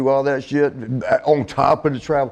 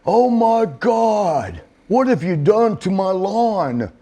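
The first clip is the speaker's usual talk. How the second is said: horrified homeowner; 7 semitones higher, much slower than usual. Retold or imitated. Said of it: "And he's like".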